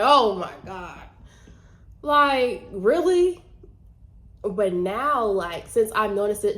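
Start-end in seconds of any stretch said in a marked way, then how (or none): 0.0-3.4 exasperated. Retold or imitated. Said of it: "Before, I'm like".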